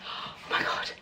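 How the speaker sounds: breathless whisper of wonder; awed whisper. Real, not acted. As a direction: whisper it, overwhelmed. Whispered awe.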